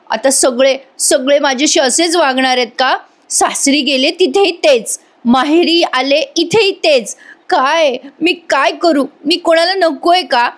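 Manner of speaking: a mild imitation of a crying, self-pitying daughter; tearful complaint. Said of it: "मोठ्या मोठ्याने रडते".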